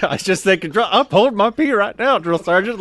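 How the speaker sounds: high, loud giggly reply; laughing retort. Retold or imitated. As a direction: blurt it out while cracking up.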